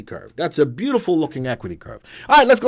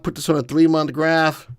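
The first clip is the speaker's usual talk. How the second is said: normal talking voice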